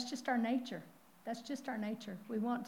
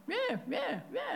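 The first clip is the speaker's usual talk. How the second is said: child saying a bad word; 11 semitones higher, slower than usual. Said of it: "and he's going".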